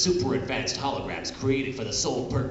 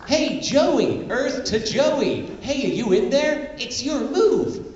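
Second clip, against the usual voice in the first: high Yugi voice; 10 semitones higher, slower than usual. Acted. Yugi voice in live parody.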